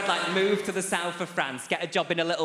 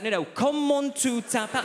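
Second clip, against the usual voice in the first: much higher, pleading French question; exasperated professor; 10 semitones higher than usual. Retold or imitated. Professor begging the student to understand, much higher. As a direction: plead the question, near despair.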